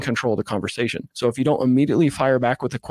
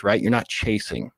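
usual speaking voice

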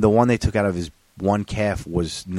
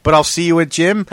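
mock brother; 9 semitones higher, 7 dB louder than usual. Mocking brother's excuse.

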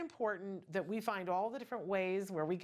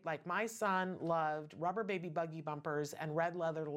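ordinary talk

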